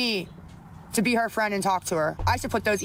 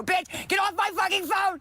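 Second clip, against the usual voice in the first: raised, higher furious yell; furious shout; 9 semitones higher than usual. Real, not acted. Streamer yelling at the woman texting her, furious shout. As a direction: blow up at the harasser. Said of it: "she's so mad".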